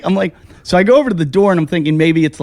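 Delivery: ordinary talk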